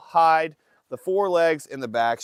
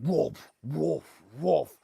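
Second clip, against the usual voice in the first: pumping sound imitation; much slower than usual.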